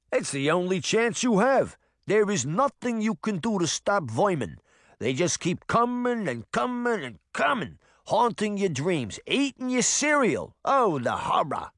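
a nervous cat veteran in broad New Jersey; rattled New Jersey accent; thick New Jersey accent. Acted. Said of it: "in a thick New Jersey accent".

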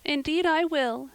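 high, lightly acted tearful promise; distressed but without real sobbing; sobbed. A narrator's reading. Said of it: "sobbed Diana".

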